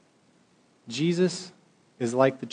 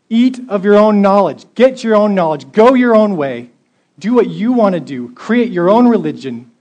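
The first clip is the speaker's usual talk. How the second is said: loud, higher preaching voice; forceful preaching; 6 semitones higher, 10 dB louder than usual. Acted. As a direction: hammer each choice at the crowd.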